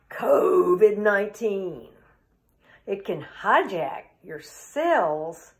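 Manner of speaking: slow, weighty line-by-line recital; dramatic poem recital. Acted. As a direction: give each new term heavy emphasis, pausing between them. Reads the poem as a kid.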